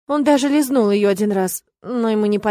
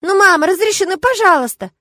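very high, loud pleading; little boy wheedling mother; whiny, insistent childish plea; begging child; 10 semitones higher, 6 dB louder than usual. A narrator's reading.

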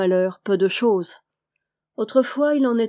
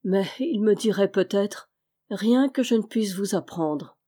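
usual speaking voice

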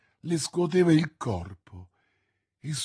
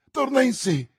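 high, loud exclamation; sudden raised cry of relief; joyful exclamation; 9 semitones higher, 5 dB louder than usual. A narrator's reading. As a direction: exclaim it as hope returns. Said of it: "esclamò il giovane".

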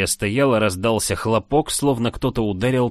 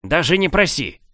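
angry refusal; 8 semitones higher, 4 dB louder than usual. A narrator's reading. King refuses angrily.

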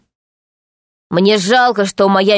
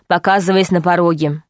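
plain reading voice

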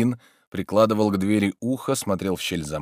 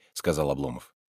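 ordinary delivery, nothing marked

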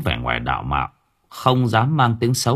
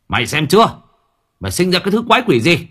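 higher, louder, fast angry scolding; scolding wife; 9 semitones higher, 3 dB louder, much faster than usual. Acted. Husband scolding his wife, louder and fast. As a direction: snap at the wife, rising fast. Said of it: "quay vào trong chửi vợ".

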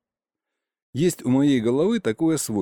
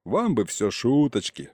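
ordinary delivery

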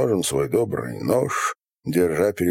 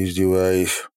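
ordinary delivery, nothing marked

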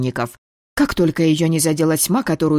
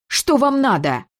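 higher, sharper snarled demand; snarling demand; 10 semitones higher than usual. A narrator's reading. The girl snarls at her father, demanding what he wants. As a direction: throw it at him with fury. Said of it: "рыкнула".

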